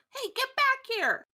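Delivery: loud, very high yell; high-pitched shouted command; Ember shouting. Acted. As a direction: call out sharply after someone running off. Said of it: "You hear Ember shout".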